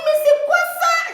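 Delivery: high, shrill-free squeal of colleagues shocked; high mock outrage of coworkers. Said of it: "On dit".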